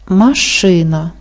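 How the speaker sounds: slow "Машина", leaning on "ши"; stress on second syllable. Shown as a demonstration. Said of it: "Наголошений другий склад". (повільно «Машина» з наголосом на другий склад)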